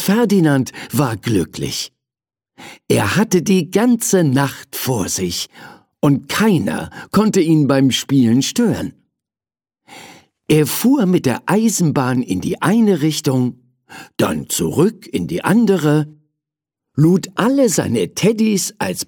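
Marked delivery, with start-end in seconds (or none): none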